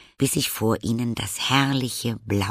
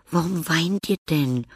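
put-on higher, wondering girl's question; puzzled; 8 semitones higher, 4 dB louder than usual. A narrator's reading. Anne asking the crying Käuer, puzzled. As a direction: ask in surprise. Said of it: "fragte Anne verwundert".

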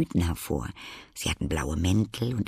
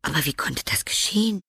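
put-on higher, astonished girl's question; surprised; 8 semitones higher than usual. A narrator's reading. Girl asking how it happened, surprised. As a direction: ask it with surprise. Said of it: "fragte das Mädchen überrascht".

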